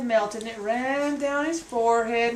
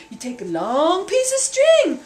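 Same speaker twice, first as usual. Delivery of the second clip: plain reading voice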